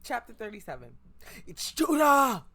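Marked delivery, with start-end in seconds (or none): 1.5-2.5 loud, high, slow shout; shouted call. Acted. Character spotting Judah shouts it out, loud and high. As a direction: shout the name out in alarm.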